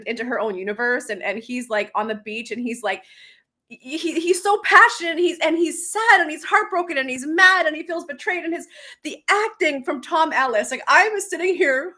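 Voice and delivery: ordinary talk, nothing marked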